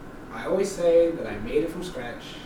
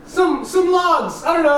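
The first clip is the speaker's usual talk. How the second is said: sheepish defensive; 11 semitones higher, 5 dB louder, slower than usual. Real, not acted. Defensive reply when challenged.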